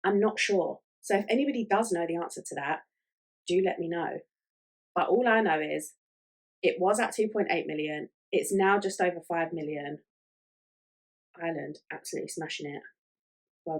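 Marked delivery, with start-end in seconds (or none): none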